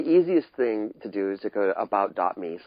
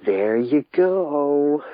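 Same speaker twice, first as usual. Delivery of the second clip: slow, stretched, higher and louder; drawn-out sing-song; 7 semitones higher, 6 dB louder, much slower than usual. Real, not acted. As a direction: draw it out playfully. Playful banter, drawn out.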